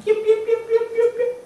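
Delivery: high, loud, rhythmic yelping; imitated dog crying. Storyteller imitating a dog hit by a car, high loud yelps. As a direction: imitate a hurt dog in sharp, even yips. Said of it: "It started crying".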